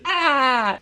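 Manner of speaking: high-pitched, drawn-out cartoon cry; SpongeBob. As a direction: squeal an annoying cartoon "ah". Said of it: "high pitched".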